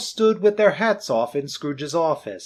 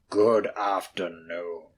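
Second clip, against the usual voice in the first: low, slow, hushed whisper; cold dismissal; 7 semitones lower, slower than usual. Acted. Scrooge dismissing his nephew, hushed and cold. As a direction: dismiss him icily.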